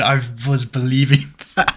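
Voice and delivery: laughing through the words, caught out; co-host laughs after falling for the made-up story; laughing at being fooled. Real, not acted. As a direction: laugh it out, half annoyed at being tricked. Caught out by a joke.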